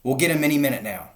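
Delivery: fast loud shout. A narrator's reading. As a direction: shout it eagerly. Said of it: "the fat Bogus shouted".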